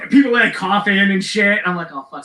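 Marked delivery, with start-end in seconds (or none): 1.9-2.2 much softer, under the breath; dismayed mutter. Retold or imitated. Singer seeing the mess he made, soft dismayed mutter. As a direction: say it to yourself as it sinks in.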